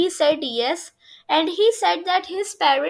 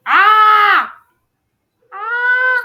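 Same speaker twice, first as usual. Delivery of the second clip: two loud, high screams; sudden loud panicked screams; 6 semitones higher, 9 dB louder than usual. Pretend. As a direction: shriek as if caught by the light. Narrator screams at the light.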